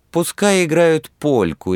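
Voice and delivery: high capricious whine; whiny spoiled pouty drawl. A narrator's reading. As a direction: pout it like a spoiled girl. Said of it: "решила капризным голосом Люба".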